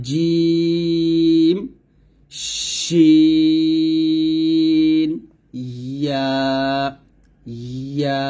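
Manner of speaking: 'জিম', 'শিন', 'ইয়া' each held very long on a level note; mid-tongue articulation. Shown as a demonstration. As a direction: draw out each letter, mid-tongue to the palate. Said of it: "সঠিক করার জন্য আপনাকে লাগাতে হবে". ('জিম', 'শিন', 'ইয়া' জিহ্বার মাঝখান লাগিয়ে টেনে)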